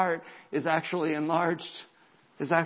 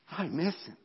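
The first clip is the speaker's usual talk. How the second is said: understated, softer ache; imagined grieving heart; 5 dB quieter than usual. Retold or imitated. A grieving family's hearts missing him, mildly sorrowful. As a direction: voice their longing softly. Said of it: "their hearts are going".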